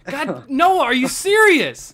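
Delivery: loud, high exasperated yell; exasperated shout. Real, not acted. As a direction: shout in disbelief at him. Friend wrecked his build.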